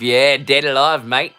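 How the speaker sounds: a hint of a put-on Australian accent. Scottish vlogger to viewers, a lightly done Australian accent. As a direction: drop in a light Australian twang, mate-style. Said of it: "My Australian accent isn't great".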